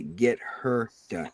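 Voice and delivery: drawled slowly for emphasis; slow drawn-out catchphrase. Shown as a demonstration. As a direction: stretch each word out slowly. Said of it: "You gotta say it right, though".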